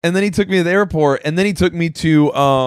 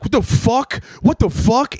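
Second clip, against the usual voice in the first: retold freak-out; 6 semitones higher than usual. Driver cursing at the GPS, mock freaking out. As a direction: imitate a driver losing it, without shouting. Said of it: "he's, like, freaking out".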